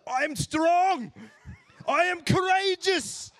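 high, loud, slow proud proclamation; booming declaration. Retold or imitated. South African driver answering a casual how are you, booming. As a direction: declare it like a battle cry.

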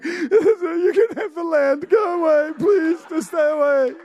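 high, wailing mock sobs; crying. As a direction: give in and beg through hammed-up tears. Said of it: "He starts crying".

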